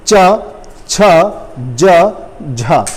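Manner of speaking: च, छ, ज, झ said one by one with pauses; repeat slowly. Shown as a demonstration. Teacher repeating letters for students to copy. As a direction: say च, छ, ज, झ again, separated. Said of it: "दोबारा बोलिए".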